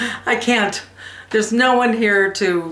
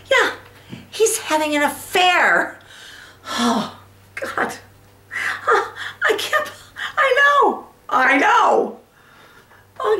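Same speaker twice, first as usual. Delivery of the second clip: starts composed, then breaks into high-pitched wailing; betrayed wife, distraught; 8 semitones higher than usual. Acted. Wife tells friends her husband is having an affair, growing distraught. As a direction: announce it evenly, then let the hurt burst out. Performs monologue from the Women.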